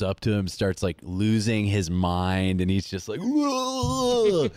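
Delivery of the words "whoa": high, loud, long mock whoa; a partygoer tripping out at the party; mock stoned whoa. Imitating the guy in the film's party scene, long loud whoa.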